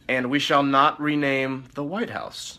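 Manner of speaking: slowly, weighing each word; slow deliberate reading. Acted. Aide to president, reading a demand slowly. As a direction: read it out slowly.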